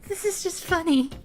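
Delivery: laughing hard, high pitched; cracking up at the scene. Real, not acted. Streamer cracks up at cutscene.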